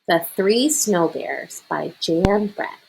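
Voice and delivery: ordinary delivery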